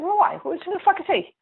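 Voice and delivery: high-pitched, exasperated protest; incredulous outburst. Retold or imitated. Senator retells objecting to ousting the leader, high and exasperated. Retold angry question.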